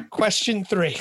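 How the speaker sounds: moving on while still laughing; laughing through it. Real, not acted. Host announcing the next question, still laughing.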